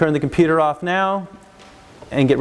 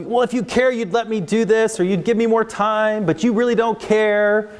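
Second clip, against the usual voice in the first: put-on guilt-tripping kid; 5 semitones higher than usual. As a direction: lay the guilt on like a sulky child. Child guilt-tripping the teacher.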